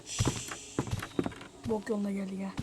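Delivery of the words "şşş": long unvoiced shushing hiss. Real, not acted. Sneaking player shushing himself. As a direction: hush yourself sharply.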